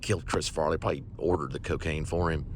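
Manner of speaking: ordinary delivery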